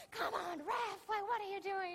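loud, high yell at the ref; sideline mom. Retold or imitated. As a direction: heckle the referee from the stands. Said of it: "one of those moms on the sidelines".

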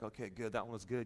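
low, soft aside to yourself; quiet imitated inner voice; private self-congratulation. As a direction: drop right down, as if thinking it. Private thought while ministering.

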